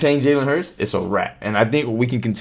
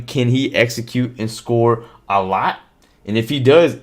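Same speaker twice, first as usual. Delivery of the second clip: ordinary talk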